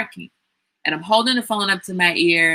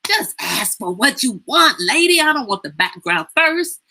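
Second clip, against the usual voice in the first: fed-up daughter thinking at her rambling mother; exasperated inner voice; 6 semitones higher than usual. Retold or imitated. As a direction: snap at her to get to the point. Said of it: "in my mind, I'm like".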